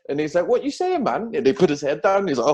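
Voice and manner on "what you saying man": high, incredulous "what you saying"; mock the friend's disbelief, pitched way up; imitating a stunned mate, much higher. Other guy's reaction, retold.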